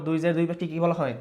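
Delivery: ordinary delivery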